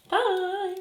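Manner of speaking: drawn-out, very high "bye"; high-pitched sign-off. Real, not acted. Vlogger signing off to viewers, high-pitched goodbye.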